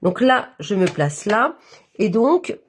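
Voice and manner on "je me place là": ordinary talk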